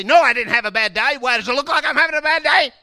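loud, high, indignant denial; defensive outburst. Acted. Wife insisting she had no bad day, loud indignant shout. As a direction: shout the denial right back.

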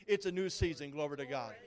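ordinary talk

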